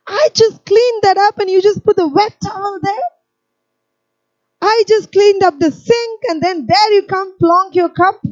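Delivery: exasperated high-pitched nagging. Retold or imitated. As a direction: whine it out, fed up with the mess. Acted complaint of annoyed housekeeper.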